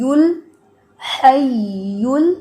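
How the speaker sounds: الحی with firm doubled ya, very slow; slow tajweed demo; firm shadda. Shown as a demonstration. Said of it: "شد کو ذرا سختی سے پڑھنا ہے".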